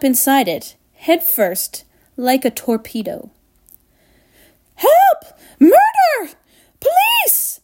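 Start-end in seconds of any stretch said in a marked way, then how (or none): 4.8-7.6 very high, loud panicked shrieks; screaming. A narrator's reading. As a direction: shriek for help word by word. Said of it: "screamed Missus Gloop".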